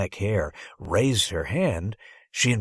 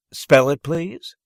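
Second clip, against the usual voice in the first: loud, higher, clipped order; curt demand; 6 semitones higher, 8 dB louder, slower than usual. Acted. Teacher ordering the boy to spell his name, curt.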